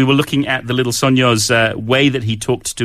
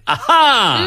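high sung [name]; excited shout; 13 semitones higher than usual. Retold or imitated. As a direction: belt it like the band name.

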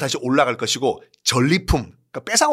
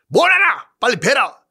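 loud, high impatient command; angry barked order; 9 semitones higher, 4 dB louder than usual. Acted. Emperor scolding soldiers.

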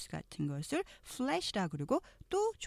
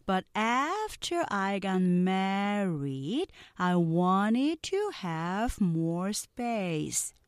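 very slow, carefully sounded English lines; slow model reading; much slower than usual. Shown as a demonstration. Teacher reading slowly for students to repeat. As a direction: read each sentence slowly for repeating. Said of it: "느린 속도 듣고 따라해".

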